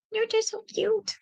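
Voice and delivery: slow high coo to a cat. Real, not acted. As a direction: dote on the cat slowly. Cooing at the cat.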